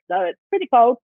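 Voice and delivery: usual speaking voice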